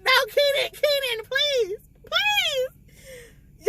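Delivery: high, sobbing pleas; crying please, please; tearful pleading. Real, not acted. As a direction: beg him to live through tears. Reader begging along with story.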